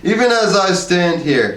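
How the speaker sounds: bellowing. A narrator's reading. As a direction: bellow it to the whole room. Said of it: "he bellowed".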